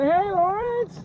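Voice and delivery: "Hey, Lawrence" sung out high with a lilt; high sing-song greeting. Shown as a demonstration. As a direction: call out the name in a bright high sing-song. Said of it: "the same voice inflection that I used".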